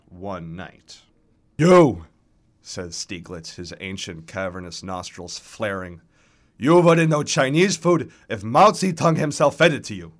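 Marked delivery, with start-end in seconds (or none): 1.6-2.0 put-on loud, indignant old man's jab; old operatic voice, loud and accusing; hoarse old man. A narrator's reading. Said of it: "hoarse, tattered remnants of an operatic baritone". 6.6-10.1 put-on loud, higher-pitched old man's scolding; old baritone worked up into a loud insult; hoarse old man. A narrator's reading. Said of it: "hoarse, tattered remnants of an operatic baritone".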